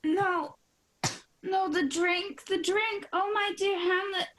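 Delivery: rising terror as the poison hits; panicked realization. Acted. Queen realizes she is poisoned.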